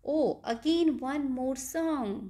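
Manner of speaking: usual speaking voice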